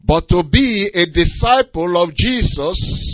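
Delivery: loud, slow, pressing on be; emphatic contrast. Acted. Preacher stressing to be a disciple, loud and slow. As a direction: lean hard into to be a disciple.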